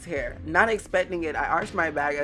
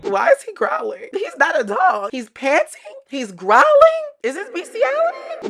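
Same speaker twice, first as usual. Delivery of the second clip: much higher and louder, disbelieving; incredulous high rant; 10 dB louder than usual. Real, not acted. Reader squealing in disbelief at the growling character.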